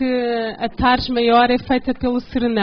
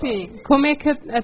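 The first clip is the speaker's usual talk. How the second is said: ordinary delivery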